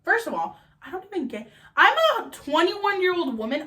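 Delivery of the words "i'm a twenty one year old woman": 1 indignant. Real, not acted. Indignant comparison.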